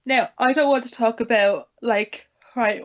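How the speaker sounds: ordinary delivery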